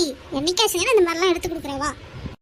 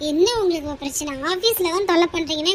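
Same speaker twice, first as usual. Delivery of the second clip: ordinary delivery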